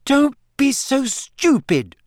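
high, slow, exasperated rebuke; shrilly offended scolding; indignant retort. A narrator's reading. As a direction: stretch each word in disbelief. Hermione retorts in acted quarrel.

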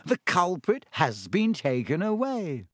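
high, lilting, spelled out for the dim; slow and condescending. A narrator's reading. Lockhart telling the class they are safe, condescendingly slow. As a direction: patronise them with stretched, swooping words. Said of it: "speaking slowly as though they were all a bit dim".

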